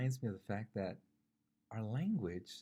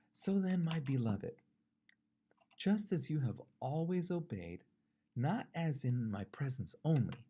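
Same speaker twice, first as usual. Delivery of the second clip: higher, emphatic Bible reading; scripture reading; 5 semitones higher, 5 dB louder than usual. Acted. Preacher reads Philippians to the congregation, emphatic scripture reading. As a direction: read the verse out with weight.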